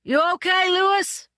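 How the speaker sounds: high, loud call across the sand; a script boy yelling to the fallen horseman; yelled. A narrator's reading. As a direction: shout out to the fallen rider. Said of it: "yelled the script boy".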